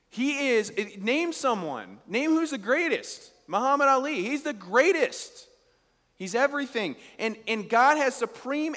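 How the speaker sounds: preacher rousing the congregation about God's greatness; preaching at full force. Acted. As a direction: rise to a rousing climax.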